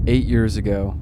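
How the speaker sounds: ordinary delivery, nothing marked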